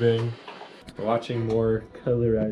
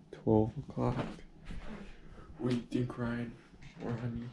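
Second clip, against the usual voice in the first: quiet; 14 dB quieter than usual. Real, not acted. Late at night on New Year's.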